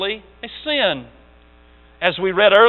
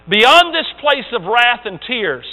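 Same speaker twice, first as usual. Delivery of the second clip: measured, solemn first reading; slow poem reading; 3 semitones higher, 4 dB louder, slower than usual. Shown as a demonstration. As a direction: let the line roll out slowly. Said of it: "You get that?".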